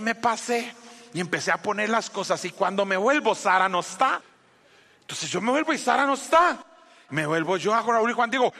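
ordinary talk, nothing marked

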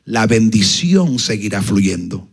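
low, slow, solemn command; the Lord instructing the preacher, solemn. Retold or imitated. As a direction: give the promise like a divine command. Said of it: "Me dijo".

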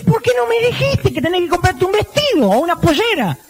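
high bewildered husband; clueless husband. Acted.

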